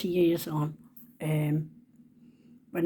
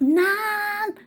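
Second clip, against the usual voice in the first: very high, drawn-out call; imitated shout; 13 semitones higher, 4 dB louder than usual. Granddaughter shouting to Nan near bus stop, imitated high holler. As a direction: holler it high across the street. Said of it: "and she shouted".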